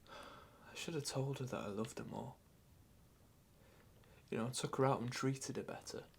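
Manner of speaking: soft, subdued confession of regret; quiet regret. Acted. Grandchild regretting not telling Nan of love, quiet remorse.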